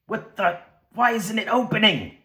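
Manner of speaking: can't get the pod open, getting scared; panicked. Pretend.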